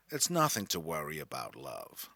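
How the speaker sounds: low, soft, lazy American drawl; transatlantic drawl. Retold or imitated. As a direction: wave away the worry in a lazy American drawl. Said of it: "she replied in her transatlantic drawl".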